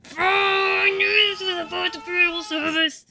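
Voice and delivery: tearful, loud anguished outburst; a hurt doctor wails she is blamed; crying character. Acted. Nurse defends herself, crying out loudly. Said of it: "my voice acting is not top tier today".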